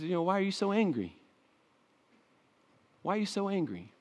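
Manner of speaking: gentle counselor question. Retold or imitated. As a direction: ask it quietly like a counselor would. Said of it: "he comes in as a counselor".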